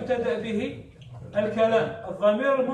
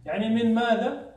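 ordinary delivery

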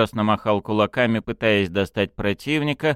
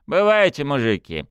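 ordinary delivery

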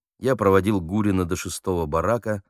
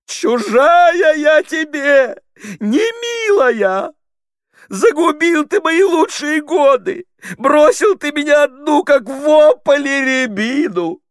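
very high, loud, drawn-out stage howl; hammed-up heroine's heavy, piercing roar; piercing anguished wail; 10 dB louder, slower than usual. A narrator's reading. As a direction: wail it out slowly at the top of your voice. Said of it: "издав тяжёлый пронзительный рёв".

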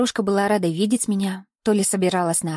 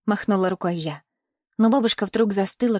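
ordinary delivery, nothing marked

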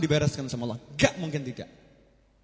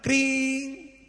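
phone ring imitation; 9 semitones higher than usual.

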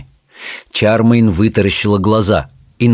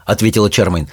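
ordinary delivery, nothing marked

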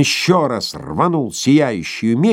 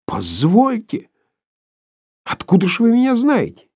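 bewildered; 13 semitones higher than usual. Acted. Korotkov bewildered, protesting.

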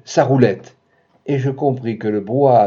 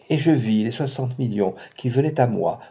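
usual speaking voice

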